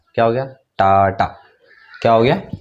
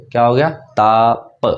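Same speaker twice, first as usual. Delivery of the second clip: ताप drawn out slowly and clearly; slow repeat; slower than usual. Shown as a demonstration. Teacher repeating ताप for pupils, slowly. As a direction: spell out ताप slowly for the class.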